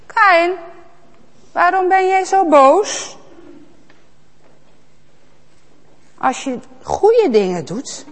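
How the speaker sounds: gently but firmly warn him; warning God. Acted. God cautioning angry Kaïn, earnest warning.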